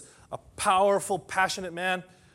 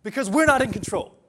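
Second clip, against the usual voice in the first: firm refusal; 6 semitones higher, 5 dB louder, faster than usual. Retold or imitated. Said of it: "David's saying".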